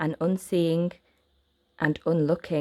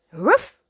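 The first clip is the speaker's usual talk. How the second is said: louder playful woof; dog bark; 4 semitones higher, 6 dB louder than usual. Acted. The dog barking happily at the children, a playful woof. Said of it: "barked Patch".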